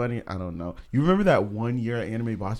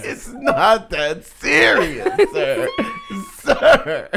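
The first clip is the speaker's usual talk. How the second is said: laughing mock reply; 14 semitones higher, 4 dB louder, much slower than usual.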